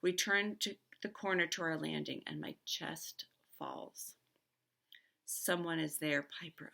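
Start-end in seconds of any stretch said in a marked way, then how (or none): 2.3-4.1 whisper. A narrator's reading. Dread at seeing someone there.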